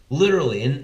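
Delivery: ordinary talk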